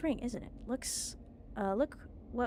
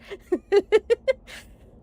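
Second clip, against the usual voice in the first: high gleeful cackle; 11 semitones higher, 16 dB louder than usual. Acted. Gloating villain laugh.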